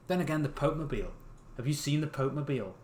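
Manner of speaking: plain reading voice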